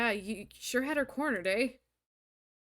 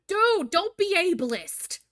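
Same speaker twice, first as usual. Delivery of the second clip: mock outrage; 6 semitones higher, 9 dB louder, slower than usual. Real, not acted. Yelling at character.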